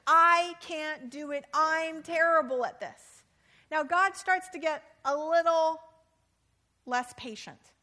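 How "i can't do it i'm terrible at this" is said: slow, louder desperate plea; pleading protest. Acted. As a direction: push back at God, slowly and louder. Moses pleading with God.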